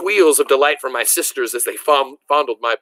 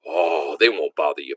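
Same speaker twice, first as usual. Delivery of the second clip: a much lower, fatherly voice; calm the boy like a steady father; reassuring papa; 7 semitones lower than usual. A narrator's reading.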